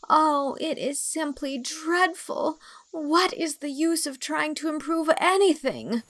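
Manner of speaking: put-on a girl's theatrical heartbroken lament; despairing lament. A narrator's reading. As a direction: wail at the futility like a tragic heroine. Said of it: "looking like the muse of tragedy".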